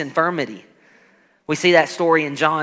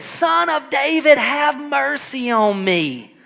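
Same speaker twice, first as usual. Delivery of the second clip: pleading cry; 8 semitones higher, slower than usual. Retold or imitated. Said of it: "he cried out all the more".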